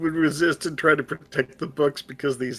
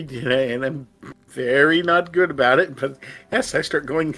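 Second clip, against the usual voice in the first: normal talking voice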